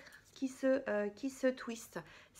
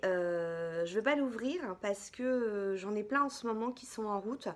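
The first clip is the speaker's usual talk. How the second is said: usual speaking voice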